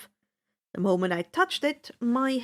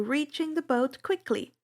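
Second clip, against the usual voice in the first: plain reading voice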